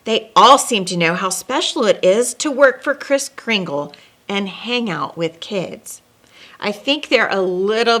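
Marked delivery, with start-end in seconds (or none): none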